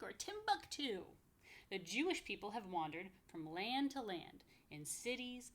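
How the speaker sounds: sing-song rhyming read-aloud, slow on the lists; lively picture-book reading; reading to children. Acted. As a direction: bounce the rhymes for little listeners.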